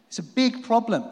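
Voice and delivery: ordinary delivery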